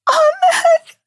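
a desperate high wail; anguished scream. A narrator's reading. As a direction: scream his name as he dies. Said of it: "Carly screamed".

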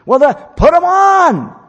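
high, loud, slow nagging parent voice; exasperated parent. Retold or imitated. Parent hurrying a dawdling kid, exasperated high loud nagging. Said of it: "And you say".

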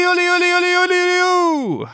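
high, rippling "uli-uli" holler ending in a long oo; high-pitched, sustained hunt call; shouted hunting cry. Acted. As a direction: ululate like a huntsman calling the hounds. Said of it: "specifically they're shouting".

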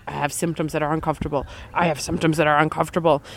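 fast, low, monotone repetition; looping thoughts. Retold or imitated. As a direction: rattle it off like a stuck thought. Voices her trapped, looping thoughts.

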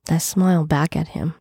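ordinary delivery, nothing marked

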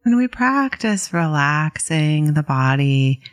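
calm, unhurried meditation guidance with long pauses; guided meditation. Real, not acted. Meditation teacher guiding listeners to settle in, calm and unhurried. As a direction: ease listeners into settling, leaving space between phrases.

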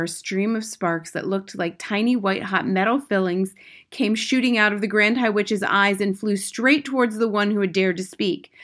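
plain reading voice

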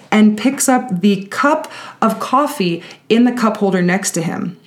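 ordinary delivery

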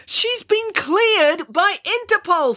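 slow, high-pitched emphatic protest; agitated, drawn-out insistence. A narrator's reading. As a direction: spell it out in exasperation, voice way up. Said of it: "He was getting so agitated".

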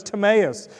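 Timaeus said as tuh-MAY-us; elder's pronunciation. Shown as a demonstration. Preacher settles on the elder's version, Timaeus as tuh-MAY-us. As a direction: put the weight on MAY in Timaeus. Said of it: "according to one of our elders".

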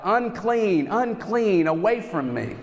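high, hushed imitation of calling out; mock yell. Leper warning anyone who comes near to stay away, mock yell. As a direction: call out high but softly, as if from afar. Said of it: "would have to yell out".

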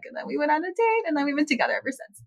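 ordinary delivery

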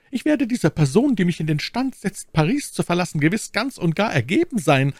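high, fervent maid's voice; dankbare Ketty. Acted. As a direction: pledge devotion gratefully, pitched high. (dankbare, hohe Mädchenstimme)

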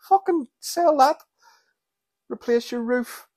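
very high, indignant mock yell; slow, high-pitched exasperated demand; outraged rant. Churchgoer to priest collecting money, hammed-up outrage.

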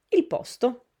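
ordinary talk, nothing marked

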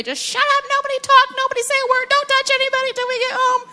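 a mom who has had it, yelling at her kids in the car, mock fury; mock car meltdown. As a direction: lose it at the kids in the back seat, hammed-up. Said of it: "you tell everybody".

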